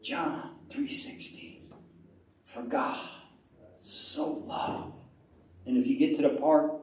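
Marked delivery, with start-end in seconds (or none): 0.0-4.9 slow, near-whispered scripture; hushed meditative murmur; saying to oneself. Shown as a demonstration. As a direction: breathe it privately, word by word. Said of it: "you start saying to yourself".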